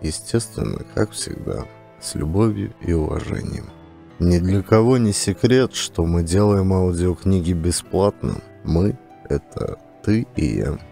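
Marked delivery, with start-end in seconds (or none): none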